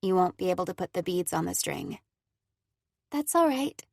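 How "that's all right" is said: consoling. Acted. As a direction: reassure her gently like a young girl. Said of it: "Cora consoles".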